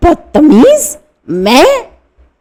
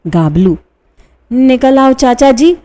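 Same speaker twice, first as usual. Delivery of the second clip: ordinary talk, nothing marked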